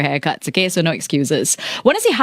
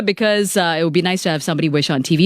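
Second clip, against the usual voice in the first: usual speaking voice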